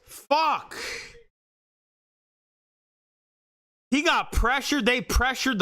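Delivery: high-pitched frustrated curse; angry outburst. Real, not acted. Fan still furious after interception.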